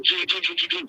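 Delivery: high buzzing gee sounds mimicking energy pulses; imitated electric buzz. Telling friends how the energy surged, high mock buzzing. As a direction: buzz like electric pistons firing. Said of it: "It's like".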